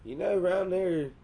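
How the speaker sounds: high, loud country twang. Acted. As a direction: lay on a rural Southern twang. Acted country store customer with twang.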